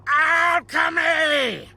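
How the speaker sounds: high, drawn-out cry of ALCHEMY; indignant outburst. Acted. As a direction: stretch ALCHEMY out in a high shriek. Indignant outburst at being doubted.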